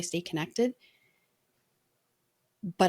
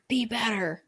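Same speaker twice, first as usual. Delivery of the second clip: mock military order, pitched up; military command; 4 semitones higher than usual. Imagining the goal as a harsh military order, mock commanding. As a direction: say it like a drill order. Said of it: "It sounded like military".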